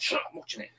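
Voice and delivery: high, unvoiced whisper snapping for silence; whispered telling-off in a cinema; hushed shush. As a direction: hiss at the talkers to shut up.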